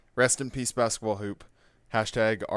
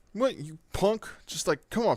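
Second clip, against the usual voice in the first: high, indignant challenge, pitched way up; aggressive taunt; 8 semitones higher than usual. Retold or imitated. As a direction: bark it like a teen bully itching to fight. Bully picking a fight.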